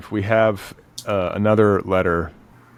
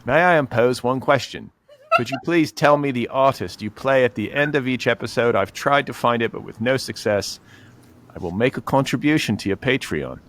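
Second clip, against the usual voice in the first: put-on higher, old-timey 1940s Mid-Atlantic voice; Mid-Atlantic accent; 5 semitones higher than usual. Shown as a demonstration. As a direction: drift into a 1940s transatlantic accent. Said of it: "went to nineteen forties Atlantic accent".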